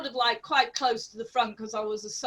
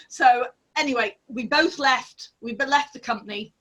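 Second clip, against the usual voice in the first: normal talking voice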